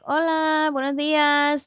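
high, bright Spanish greeting; Spanish accent. Retold or imitated. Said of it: "you do it with the accent".

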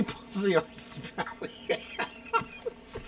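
words dissolving into laughter; cracking up. Real, not acted. Host reads a crude question, cracking up helplessly. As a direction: lose it laughing as you read.

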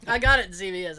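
usual speaking voice

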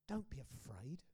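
hushed reassurance; whispered comfort. Acted. Risen Jesus comforting the fallen John, whispered. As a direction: whisper it gently. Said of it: "and he said".

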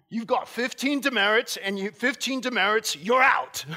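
put-on loud, high Australian accent, laughing through it. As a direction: play the stern Australian dean handing out demerits. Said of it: "I just didn't get the accent there".

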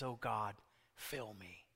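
low, very soft, sinking almost to nothing; hushed prayer. Acted. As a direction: quietly plead as an empty vessel before God. Preacher voices a humble prayer.

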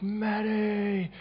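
high excited call across the yard. Retold or imitated. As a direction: call his name out gladly. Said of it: "they go like".

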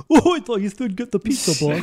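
dopey high henchman voice; doofus henchman voice. Retold or imitated. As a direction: play the dim-witted henchman. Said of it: "a bit of a doofus".

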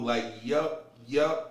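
low, deadpan yup; dead mode. Retold or imitated. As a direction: imitate being coolly switched off, voice dropped. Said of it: "I'm in that bitch on dead mode".

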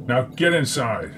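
lightly voiced commander giving a warning; a hint of a wary leader; stern first leader. A narrator's reading. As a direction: order a subordinate with authority.

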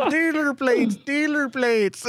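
high, hammed-up Fargo accent; sing-song Minnesotan mimicry. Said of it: "They're from Fargo".

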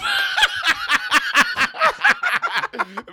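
big shrieking laugh; loud high laugh. Real, not acted. Cracking up about leaving the clinic, loud laugh. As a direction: burst out laughing loudly.

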